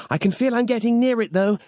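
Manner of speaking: high, hurried gasping voice; gasped. A narrator's reading. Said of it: "she gasped".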